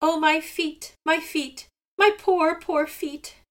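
high, loud wailing cry over sore feet; crying in pain. A narrator's reading. Old Seagull crying to Raven about his feet, high loud wail. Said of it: "cried Seagull".

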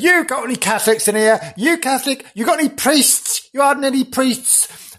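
high, rough demanding questions; marauding Protestants. Retold or imitated. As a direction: bark the questions like a hostile searcher. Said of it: "Protestants came around and said".

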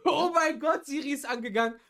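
loud, high-pitched real surprise; startled outburst. Real, not acted. Siri switches on by accident mid-stream, startled. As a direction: burst out, caught completely off guard.

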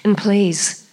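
sexy voice. Pretend. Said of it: "I should be doing this in the sexy voice".

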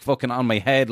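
ordinary talk

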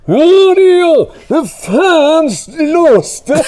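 very high, loud, distant yell; a trapped friend yelling from behind a door; muffled yell. Retold or imitated. Tommy yelling from behind the locked door, loud and high. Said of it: "hör man långt bakom den här dörren".

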